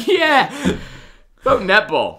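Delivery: high, loud cheering; rally the listeners; excited cheer. Real, not acted. Cheering vote for netball.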